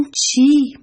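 high, loud question of disbelief; astonished. A narrator's reading. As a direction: react with storybook amazement. Fish astonished at being told. (با تعجب و ناباوری)

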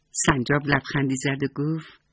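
ordinary delivery, nothing marked

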